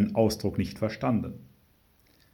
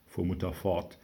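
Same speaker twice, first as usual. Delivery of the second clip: ordinary delivery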